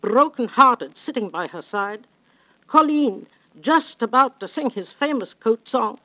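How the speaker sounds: ordinary talk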